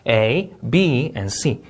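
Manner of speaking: "A, B," rising, "and C" falling; list intonation. Shown as a demonstration. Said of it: "나열식 구조가 또 나왔습니다". ("A, B"는 올리고 "C"는 내려 읽기)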